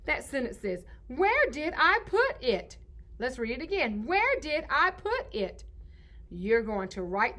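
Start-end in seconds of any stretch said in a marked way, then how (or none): 1.1-2.8 slow, high, deliberate question; lifted, stretched sentence reading; model reading. Shown as a demonstration. 4.1-5.6 slow, high, deliberate question; lifted, stretched sentence reading; model reading. Shown as a demonstration.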